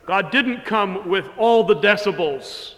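booming on the decibels; a preacher's loud demo of power. Shown as a demonstration. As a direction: let it ring out big. Preacher contrasts loud and quiet.

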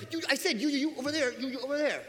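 a mock exasperated coach barking orders; hammed-up frustrated coach pointing out players. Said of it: "he would get frustrated".

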